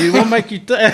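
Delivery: high, loud, laughing comeback. Real, not acted. Teased country boy asking how his friend can tell, laughing. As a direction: blurt it out through laughter.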